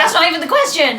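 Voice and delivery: high, loud indignant protest; indignant retort. Retold or imitated. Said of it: "And I was like".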